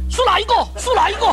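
mock teacher shout. Angry teacher demands the culprit from the class, mock shouting. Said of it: "he kept shouting".

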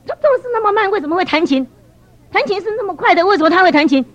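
high-pitched baffled questioning; incredulous puzzling. Retold or imitated. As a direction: puzzle aloud as if it makes no sense. Puzzling aloud over slow colleague playing.

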